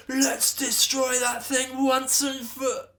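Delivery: high slow loud war cry; battle shout. A narrator's reading. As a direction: charge in yelling.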